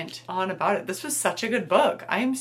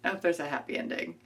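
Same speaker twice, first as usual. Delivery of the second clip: plain reading voice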